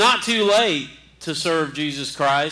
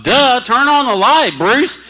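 mock self-scolding; 7 semitones higher, 7 dB louder, slower than usual. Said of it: "I thought".

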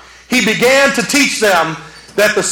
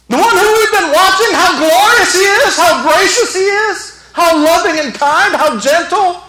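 much higher, disbelieving protest; incredulous outcry; 10 semitones higher, 4 dB louder than usual. Acted. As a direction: cry out as if you can't believe it. Voices stunned disbelief at the crucifixion.

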